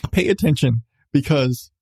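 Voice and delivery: normal talking voice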